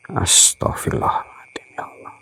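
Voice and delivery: scared whisper under the breath; whispered prayer. Real, not acted. Host to himself when the spirit cries, whispering. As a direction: hush it like a prayer in the dark.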